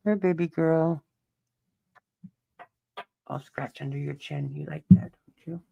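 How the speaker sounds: high, soft cooing to the cat; soft pet talk. Real, not acted. Owner cooing to his cat while scratching her chin. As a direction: soothe the cat gently.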